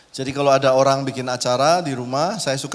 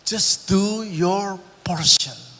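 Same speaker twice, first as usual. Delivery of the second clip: very slow, every word spaced out; slow emphasis; 4 semitones higher, much slower than usual. Real, not acted. Preacher exhorts the congregation, very slow and deliberate. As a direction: lay down each word slowly and deliberately. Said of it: "preacher drives point slowly".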